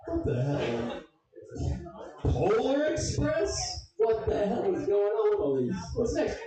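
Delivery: high, drawn-out incredulous questions; mock outrage. Comic ranting about the express names, high mock disbelief. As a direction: stretch each name in disbelief.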